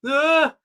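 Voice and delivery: loud, high groan of horror; acted agonized "ah"; cringing scream. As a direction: cry out in pain at the cringe. Cringe reaction after quote.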